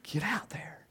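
whispered urging aside. Real, not acted. Preacher whispers to the congregation to go out. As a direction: breathe it as a secret nudge. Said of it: "whispered aside".

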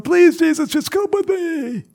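very high, hammed-up begging wail; mock desperate pleading. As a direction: beg as if on your knees, exaggerated. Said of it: "falling on his knees going".

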